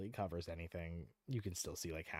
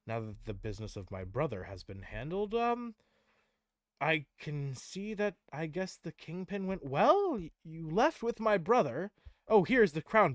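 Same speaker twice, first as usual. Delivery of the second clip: higher, louder lordly voice; a pleased angel handing back news; Tyrael's voice; 7 semitones higher, 6 dB louder than usual. Acted. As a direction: Tyrael greeting the party after the Kingpin. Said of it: "He says".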